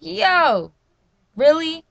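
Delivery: high, loud retold protest; indignant callout. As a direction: call out the bad driver in disbelief. Retold reaction to being cut off.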